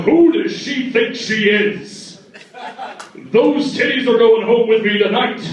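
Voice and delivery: a swaggering, lightly voiced Gaston; mild mock Gaston bravado; drunk Gaston. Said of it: "talk like Gaston from Beauty and the Beast".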